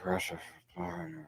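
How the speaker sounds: low, soft, slow mumbled growl; Harrison Ford. Shown as a demonstration. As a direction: mumble it into a trailing grumble. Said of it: "put some more mumbles in there".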